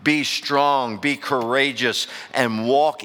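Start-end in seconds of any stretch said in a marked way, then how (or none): none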